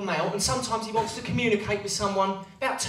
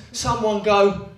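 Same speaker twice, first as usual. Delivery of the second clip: ordinary delivery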